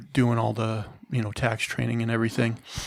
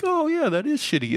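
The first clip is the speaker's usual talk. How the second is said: high, sarcastic voice mocking a jerk's fake remorse; mock dawning realization. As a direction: mimic a harasser suddenly 'getting it', much higher. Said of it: "they're gonna read that and be like".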